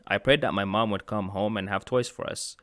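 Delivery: normal talking voice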